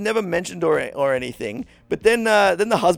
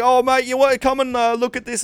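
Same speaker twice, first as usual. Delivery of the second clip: calling out; 7 semitones higher than usual. Retold or imitated. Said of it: "he's, like, calling out".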